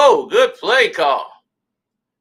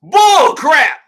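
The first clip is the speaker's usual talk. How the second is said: loud, high outburst of protest; angry shout; 6 semitones higher, 7 dB louder than usual. Real, not acted. Gamer furious at the game robbing him, loud angry yell. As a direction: yell it at the screen in outrage.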